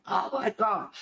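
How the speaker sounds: a mild pretend panic at normal volume; mock freak-out. Host imitating viewers freaking out at her tarantula, mock freak-out. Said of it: "People are freaking out going".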